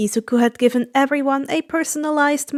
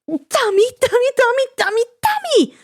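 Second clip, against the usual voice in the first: crying out; 11 semitones higher, faster than usual. Acted. Inko hitting the broker, high tearful scolding. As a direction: pound the words out like small fists. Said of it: "Inko cried".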